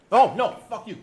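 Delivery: sudden loud, high outburst; real startled protest, high and loud; alarmed refusal. Real, not acted. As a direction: blurt it out in loud dismay. Sudden dismay at what just happened.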